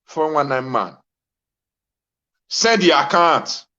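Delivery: normal talking voice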